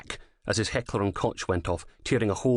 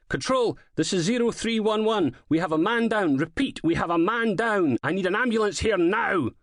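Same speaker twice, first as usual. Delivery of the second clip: high, urgent report to control; urgent radio call; 12 semitones higher, 3 dB louder than usual. A narrator's reading. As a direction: call for help over the radio. Officer radios man down urgently.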